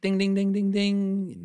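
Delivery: high, loud ding ding sound effect; game show bell. Pretend. Quiz host rings correct answer.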